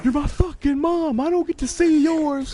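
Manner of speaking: high, loud, slow outraged yell; retold furious scream. Teen on the mic screaming at his mom, high and loud. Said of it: "he fucking screams".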